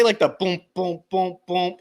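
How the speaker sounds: soft booming beat sounds; mimicking a rave bass line; imitated bass beat. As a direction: thump it out like a rave drop.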